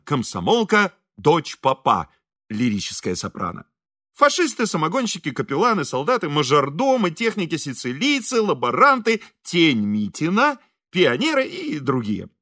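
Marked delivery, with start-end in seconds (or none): none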